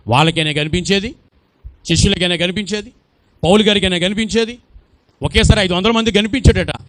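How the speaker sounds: ordinary delivery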